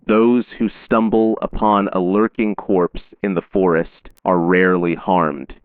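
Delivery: usual speaking voice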